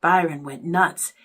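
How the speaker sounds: plain reading voice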